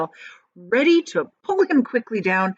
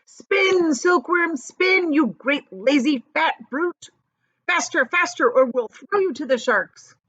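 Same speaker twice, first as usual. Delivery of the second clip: much higher, frantic yelling; frantic shouting; 8 semitones higher, 3 dB louder than usual. A narrator's reading. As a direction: drive the spinners on in a frenzy.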